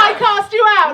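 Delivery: high, loud rallying shout; rousing call to the audience. Acted. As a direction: yell the spell to the crowd.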